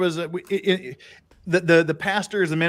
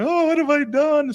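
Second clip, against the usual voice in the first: mock crying; 13 semitones higher, 4 dB louder than usual. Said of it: "crying next to me going".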